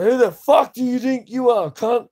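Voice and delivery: high, loud re-enacted yell; angry telling-off. Man telling off a big guy yelling at kids, angry yell. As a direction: go off at him at full volume, pitched right up. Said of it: "I went off at him".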